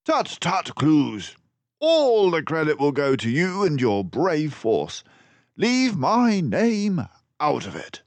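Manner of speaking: high, loud detective voice; modest detective. Acted. Basil refusing credit from the constable. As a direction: wave off the praise.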